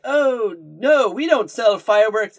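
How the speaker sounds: high, emphatic shopkeeper refusal; fake Apu. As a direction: imitate a shopkeeper's loud, high denial. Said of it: "the fake Apu's like".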